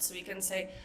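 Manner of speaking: normal talking voice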